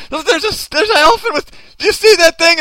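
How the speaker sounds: high, loud, frantic pointing-out; hammed-up astonished cry; acted alarm. Acts out spotting an unseen elephant.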